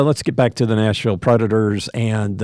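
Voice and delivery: ordinary delivery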